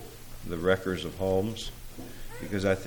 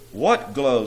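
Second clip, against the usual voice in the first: ordinary talk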